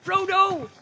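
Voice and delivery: very high, loud searching shout; breathless high cry; panting call. A narrator's reading. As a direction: call out hard while winded. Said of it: "panting and calling".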